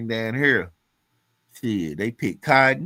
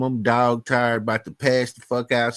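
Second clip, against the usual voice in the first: usual speaking voice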